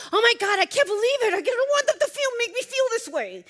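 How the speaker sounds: high, loud, fast fretful inner voice; mock self-talk. Imitating someone scolding themselves for feeling hurt, high, loud, fretful. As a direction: act out a panicked inner critic. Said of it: "Telling yourself".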